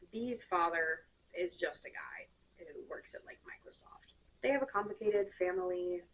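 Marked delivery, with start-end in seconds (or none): 0.1-4.1 a throwaway whispered jab; whispered aside. Real, not acted.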